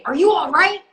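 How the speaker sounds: high, loud mock concern; acted concerned co-star. Imitating co-star leaning into the frame, loud mock concern. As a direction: call it out high, over-earnest. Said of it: "lean into the frame and say".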